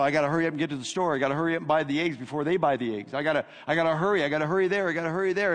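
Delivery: fast, breathless panic; panicked rushing. Retold or imitated. Preacher acting out panic-buying eggs, fast and rushed. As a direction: rattle it off in a hurry. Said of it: "This is panic".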